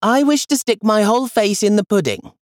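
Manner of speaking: put-on high, eager little child voice; little boy wishing for pudding; small boy Charles. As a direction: voice a tiny greedy boy, high. Youngest boy speaks.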